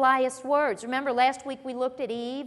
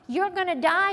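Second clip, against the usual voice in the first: ordinary talk, nothing marked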